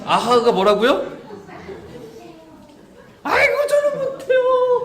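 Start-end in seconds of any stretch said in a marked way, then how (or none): 3.2-4.8 much higher, loud, pleading cry of refusal; panicked wail. Retold or imitated. Church member begging the pastor off a duty, panicked wail. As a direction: yelp like begging to be spared. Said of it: "살려달라는 거예요".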